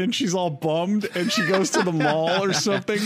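ordinary delivery

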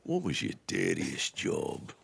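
low, soft, slow Australian question. Retold or imitated. Mocking the repeated DJ question, low slow Australian accent. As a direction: drag it out deep like the Aussie DJ. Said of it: "What you hear in Melbourne is".

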